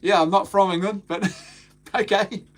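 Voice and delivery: wry comeback ending in a laugh; a light, amused "but okay"; shrugging off a commenter's jab with a laugh; dismissive retort. Retold or imitated. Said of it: "I was like".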